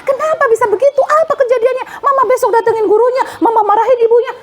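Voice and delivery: mock fury, ready to storm the school; overreacting mother. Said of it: "Bukan begitu".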